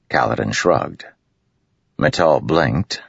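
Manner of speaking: plain reading voice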